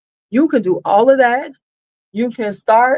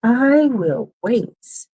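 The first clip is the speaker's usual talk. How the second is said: very slow pointed; 2 semitones higher, much slower than usual. Real, not acted. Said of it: "pointed waiting".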